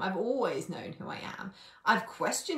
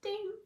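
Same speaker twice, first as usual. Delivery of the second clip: ding; 13 semitones higher, 4 dB quieter than usual. Pretend. Mimics notification chime.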